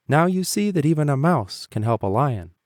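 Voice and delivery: little mouse teasing the lion; proud little mouse. A narrator's reading. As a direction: remind the lion in a small high voice. Mouse voice in story.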